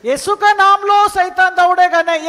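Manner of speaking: loud, high exhortation; fiery preaching. Acted. Pastor urging faith in Jesus' name, fiery. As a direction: drive the point home at full voice.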